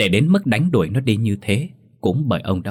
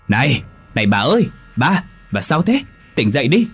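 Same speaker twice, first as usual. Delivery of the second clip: louder, urgent wake-up call; 5 semitones higher, 4 dB louder than usual. A narrator's reading. Husband calls his wife out of her nightmare, urgent. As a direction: shake her awake with your voice.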